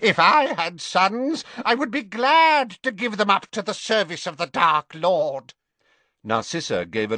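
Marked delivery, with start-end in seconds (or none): 0.0-5.5 high, hard and cold; ruthless Bellatrix. A narrator's reading. Bellatrix scorning her weeping sister, ruthless. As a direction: sneer it down at her.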